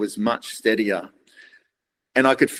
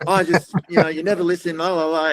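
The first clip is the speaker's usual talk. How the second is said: higher mock rant from the wife; 7 semitones higher than usual. Husband imitates his wife ranting that he never listens, higher pitched. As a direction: parody her going off at him. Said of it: "going off on this rant".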